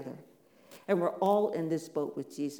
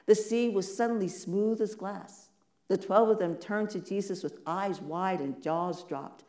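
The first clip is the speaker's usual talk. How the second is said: ordinary delivery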